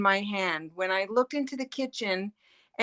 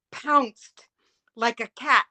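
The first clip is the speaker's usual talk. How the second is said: ordinary talk, nothing marked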